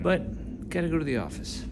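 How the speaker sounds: ordinary talk, nothing marked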